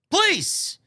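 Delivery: high, pleading please. Real, not acted. Begging Vince McMahon to leave Omos out, high plea. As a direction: beg him, voice jumping high.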